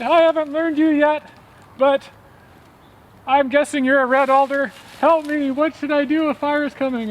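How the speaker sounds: high, loud frantic mouse cry; a tiny mouse shouting up a tree for help; panicked little mouse calling up high; little mouse yelling. A narrator's reading. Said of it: "And he said".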